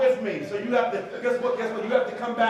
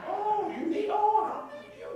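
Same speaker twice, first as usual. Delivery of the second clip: high, teasing singsong voice; mock the spouse who sulks, pitched well up; playful high mimicry of a pouting partner; mocking stubborn spouse; 11 semitones higher than usual.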